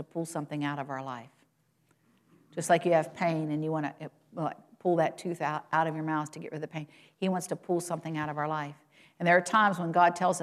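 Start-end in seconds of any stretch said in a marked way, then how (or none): none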